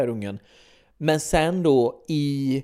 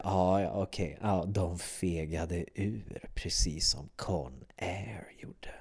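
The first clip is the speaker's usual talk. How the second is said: hushed whisper, almost no voice; whispered thought; 8 semitones lower, 11 dB quieter, slower than usual. Retold or imitated. While watching Warlock, a whispered private thought. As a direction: whisper the disappointed thought to yourself. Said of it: "Och jag tänkte".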